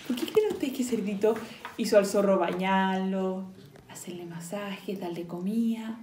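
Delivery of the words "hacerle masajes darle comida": whisper. Acted. Whispered aside to kids.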